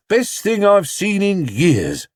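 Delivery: slow, amused bystander; a delighted onlooker; man in crowd. Acted. Man in the crowd chatting to Mrs Brown, amused.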